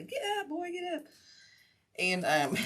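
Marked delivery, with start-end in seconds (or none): 0.0-1.0 high, mock coaxing call to a dog. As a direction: urge the lazy dog up, pitched high. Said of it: "trying to say".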